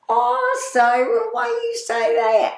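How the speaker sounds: high, loud hurt protest, played up for laughs; [name]'s mock protest. Friend [name] protests her Cabbage Town joke, high mock-wounded squeal.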